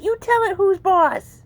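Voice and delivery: slow, high coaxing to the kitten; coaxing pet talk. Real, not acted. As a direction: draw it out for the kitten. Cheering kitten on.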